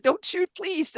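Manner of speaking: high, begging goblin voice; pleading goblin. Retold or imitated. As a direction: plead for mercy in a tiny voice. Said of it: "And, and".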